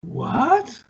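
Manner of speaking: high, rising, puzzled question; real surprise. Real, not acted. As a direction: react in baffled disbelief. Surprised by forgotten retirement match.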